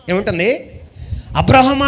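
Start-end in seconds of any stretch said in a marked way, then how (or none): 1.4-1.9 higher, louder divine call of అబ్రహమా; God calling. Pretend. God calls out to Abraham, booming call.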